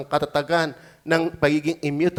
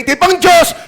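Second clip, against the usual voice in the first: high, loud mocking quote of a pious excuse; mocking the hypocrite who says it's for God; mock pious claim; 11 semitones higher, 8 dB louder than usual. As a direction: shout the hypocrite's line. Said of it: "huwag mong sasabing".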